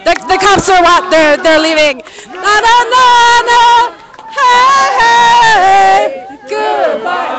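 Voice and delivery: loud high yelling. Real, not acted. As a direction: yell after them as they leave. Yelling at departing cops.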